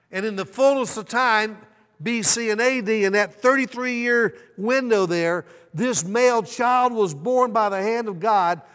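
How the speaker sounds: loud, high, rising preacher's build; preaching at full force. Acted. Preacher warns the congregation God is coming, full-force preaching. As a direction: drive it home to the congregation.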